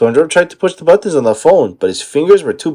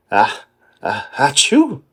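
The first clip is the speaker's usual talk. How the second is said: sneeze build-up; 4 dB quieter, slower than usual. Acted. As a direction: gasp twice then sneeze.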